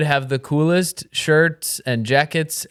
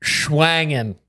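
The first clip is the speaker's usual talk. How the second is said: goofy mock word. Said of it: "And you said".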